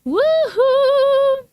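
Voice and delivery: high, drawn-out whoop; high woohoo. Real, not acted. Livestream host greeting viewers, high excited whoop. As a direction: let out a high cheer.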